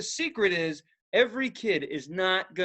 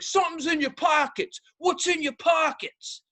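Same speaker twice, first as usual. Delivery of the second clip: mock guard voice; 7 semitones higher than usual. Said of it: "She's like".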